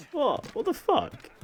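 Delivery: real startled high exclamation; shocked disbelief. Real, not acted. Ship glitches in game.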